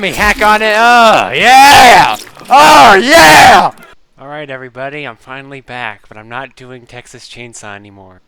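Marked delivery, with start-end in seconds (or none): 0.0-0.7 loud shout. Acted. Hammy intro shout. 0.8-2.2 loud shout. Acted. First intro shout. 2.5-3.7 louder, stretched yeah; bigger shout. Acted. Second, bigger intro shout.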